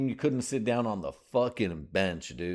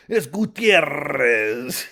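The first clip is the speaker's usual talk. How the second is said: "Gutierrez" drawn out very slowly, higher and louder in a thick Mexican accent; heavy Mexican accent; 7 semitones higher, 10 dB louder, much slower than usual. Shown as a demonstration. Comic hamming up his name to sponsors, Mexican accent. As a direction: roll out "Gutierrez" like a proud announcer, Mexican accent. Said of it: "go hella beaner with it".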